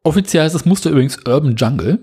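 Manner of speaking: normal talking voice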